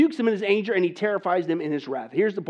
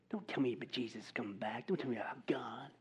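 hushed, much lower whisper; whispered mock dismissal; 7 semitones lower, 16 dB quieter than usual. Preacher voicing the world refusing to hear of Jesus, hushed whisper. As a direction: breathe it out as a scornful aside.

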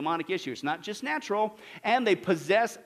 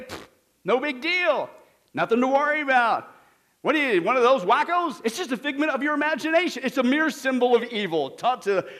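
loud, higher-pitched mimicked scoffer; mocking dismissal; 6 semitones higher, 6 dB louder than usual. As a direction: scoff loudly, imitating a dismissive skeptic. Mocks those who deny demons exist.

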